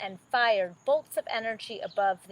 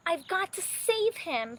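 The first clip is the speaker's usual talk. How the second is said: Ariel's voice; 10 semitones higher than usual. Acted. Ariel resolves to save prince.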